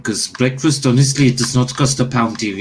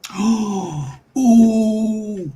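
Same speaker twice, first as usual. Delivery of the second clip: long, high drawn gasp; 10 semitones higher than usual. Real, not acted. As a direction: draw in a long gasp at the roll. Player reacts to requested roll.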